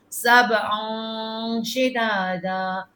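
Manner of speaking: سبعا شدادا very slow, the hidden نون hummed through the nose; slow nasalised recitation of سبعا شدادا; nasal ghunna. Shown as a demonstration. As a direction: draw سبعا شدادا out with a nasal hum at the join. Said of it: "نون کی آواز نکلے گی ناک میں".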